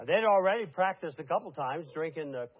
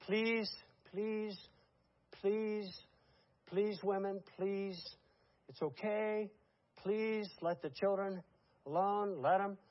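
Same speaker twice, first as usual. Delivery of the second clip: higher and softer, coaxing please after please; calm the screaming mothers, gently urgent; soft hushing plea to the women; pleading softly; 4 semitones higher, 4 dB quieter, slower than usual. Retold or imitated. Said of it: "and he's saying".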